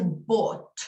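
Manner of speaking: bought with a round, long O; English pronunciation. Shown as a demonstration. Teacher modelling bought for Spanish speakers, round long O. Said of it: "Una O bien redonda, bien larga".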